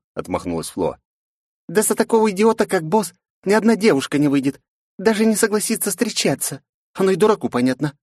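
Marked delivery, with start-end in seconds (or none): 1.7-7.9 put-on high, reproachful girl voice; indignant young woman. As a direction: scold the boss in a high voice. Narrator voices Flow.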